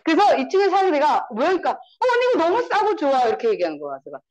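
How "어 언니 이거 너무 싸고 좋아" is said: put-on high-pitched gushing to an older friend; lifted, keen sales-pitch voice; imitated enthusiasm. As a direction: act out a friend's delighted praise. Acting out friend's enthusiastic praise.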